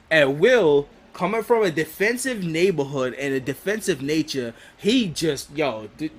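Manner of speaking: ordinary talk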